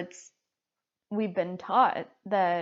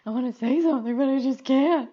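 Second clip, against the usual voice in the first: tear-choked voice, pitched up; higher, wobbly voice of someone crying; crying while talking; 6 semitones higher, 6 dB louder, faster than usual. Real, not acted. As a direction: push the words through real tears. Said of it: "you sound funny when you talk when you're crying".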